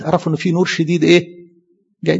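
usual speaking voice

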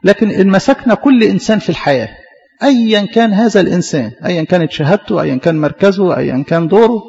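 ordinary delivery

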